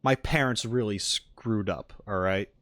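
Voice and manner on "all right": normal talking voice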